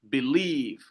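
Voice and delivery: "Believe" with the second syllable drawn out; stress on second syllable. Shown as a demonstration. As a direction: lean on the "lieve" in "Believe". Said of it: "un stress syllable followed by".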